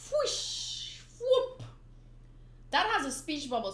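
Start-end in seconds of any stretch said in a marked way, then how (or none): 0.1-1.6 high, airy whoosh then sharp whoop; sound effects. Acted. Reader voicing comic sounds, high and sharp.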